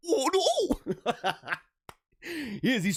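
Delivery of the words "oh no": much higher, laughing "oh no"; mock panicked sheep, laughing; sheep's panic. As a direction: squeal it like a spooked sheep. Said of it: "His face".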